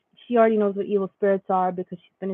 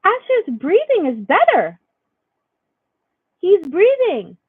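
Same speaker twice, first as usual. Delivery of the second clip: put-on high, slow little-girl voice; daughter's voice; 9 semitones higher, 6 dB louder, slower than usual. Mom quoting her daughter's remark, high child voice. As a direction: voice her five-year-old daughter, light and high. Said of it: "she's like".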